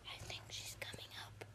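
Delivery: urgent hushed whisper; whispering while hiding. Real, not acted. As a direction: whisper a warning, hidden. Sister approaching, hiding.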